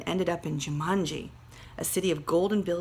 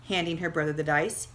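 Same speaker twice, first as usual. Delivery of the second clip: ordinary delivery, nothing marked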